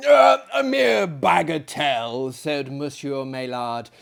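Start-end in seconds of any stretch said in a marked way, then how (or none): 0.1-2.3 lightly voiced dismissive French host, louder; asylum director. Monsieur Maillard shrugging off the commotion, lightly voiced. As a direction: brush it off as a trifle.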